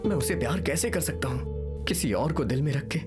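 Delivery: low, heavy-hearted confession; subdued anguish. Acted. Son doubting marriage while loving another, subdued and sad. Son torn over lost love.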